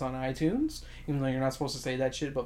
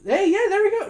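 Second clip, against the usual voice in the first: high loud exclamation; excited; 8 dB louder than usual. Real, not acted. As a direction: jump on the idea. Excited agreement.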